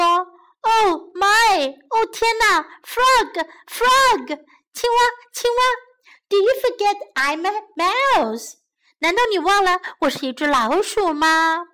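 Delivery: high, alarmed mouse calling out; frantic storybook mouse, pitched high; panicked mouse. Acted. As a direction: cry out to the frog in acted panic. Mouse panics as frog dives.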